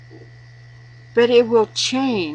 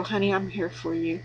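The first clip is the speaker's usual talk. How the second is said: tender reassurance; 8 dB quieter than usual. Retold or imitated. Husband confiding, wife comforting.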